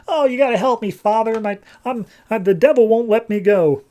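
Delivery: high-pitched imitation of a frightened boy; mock desperate plea. Possessed boy begs the priest for help, mock desperate.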